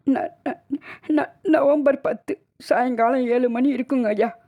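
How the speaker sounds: high, slow, halting answer through tears; tearful stammering. Acted. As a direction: stumble on the first syllable, grieving. Weeping woman stammers the date. (அழுகையில் திக்கிப் பேசுதல்)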